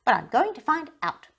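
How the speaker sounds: stubborn little engine; a cross little engine; cross, determined. A narrator's reading. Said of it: "said Tootle crossly".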